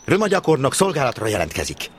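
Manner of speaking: loud, crisp reporting for duty; soldierly report. A narrator's reading. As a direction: stand at attention and announce yourself. (katonás, hangos jelentkezés)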